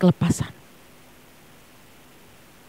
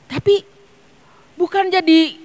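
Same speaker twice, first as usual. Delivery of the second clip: high, lightly raised quote of an elder; quoting Mami; 11 semitones higher than usual. Retold or imitated. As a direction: relay her words with rising fervour. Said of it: "Dia bilang seperti ini". (mengutip Mami dengan semangat tinggi)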